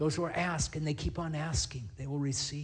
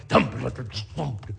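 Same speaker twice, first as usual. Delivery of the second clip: imitated drum thumps; 5 dB louder, much slower than usual. Imitating party drums.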